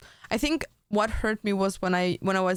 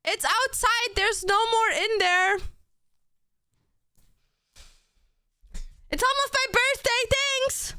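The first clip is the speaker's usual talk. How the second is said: calling out; 13 semitones higher than usual. Real, not acted. Calling to family elsewhere.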